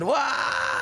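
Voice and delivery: sudden high anguished cry; a shocked, high-pitched why; screamed why. Retold or imitated. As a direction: shout it out in disbelief. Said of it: "And I screamed to myself".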